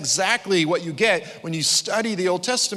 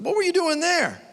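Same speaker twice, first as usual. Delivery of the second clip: surprised New Yorker. Retold or imitated. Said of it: "He goes".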